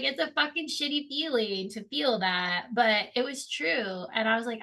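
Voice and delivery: ordinary talk, nothing marked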